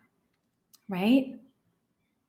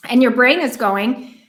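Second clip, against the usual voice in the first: ordinary delivery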